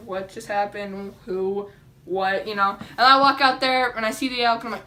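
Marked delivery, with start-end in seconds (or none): 0.1-2.5 low, softer, mock just-woken mumbling; slow, sleepy confusion; groggy. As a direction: act dazed, fresh from a nap. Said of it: "I'm super tired".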